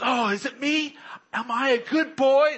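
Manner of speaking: put-on a dog hoping it's a good boy; eager dog. Dog answers a compliment.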